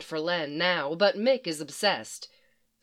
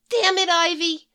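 higher, louder, yelling at an absent friend; exasperated outburst; 10 semitones higher, 5 dB louder than usual. Acted. Harley cursing Ivy for giving them away, loud and much higher. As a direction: blurt it out, fed up.

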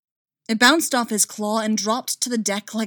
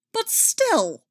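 louder, higher exasperated cry; 11 semitones higher, 4 dB louder than usual. A narrator's reading. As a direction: throw up your hands. Narrator's inner exasperation.

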